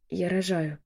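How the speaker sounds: understated groan from the chest; chesty moan. A narrator's reading. Woman fighting a contraction, chesty moan. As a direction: moan it through a mild contraction. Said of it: "простонала я грудным голосом".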